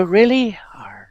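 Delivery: bright Really then a whispered Arr; mock pirate. Fitting in with the pirate guy, whispered Arr. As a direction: drop to a breathy pirate Arr. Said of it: "You go".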